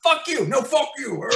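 imitating two men yelling at each other; retold shouting match. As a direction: replay a shouting match in short bursts. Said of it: "we're just yelling at each other".

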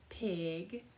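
ordinary delivery, nothing marked

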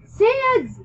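high, drawn-out screamed name. A narrator's reading. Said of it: "I screamed".

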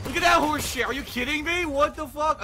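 loud, high, exasperated outburst; raging at the game; angry disbelief. Real, not acted. Player rages at failed kill.

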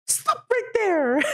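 high, drawn-out cartoon command; stretched, high-pitched hero shout; Mario voice. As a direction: call a halt in a high character voice. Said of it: "Let me try out my Mario voice".

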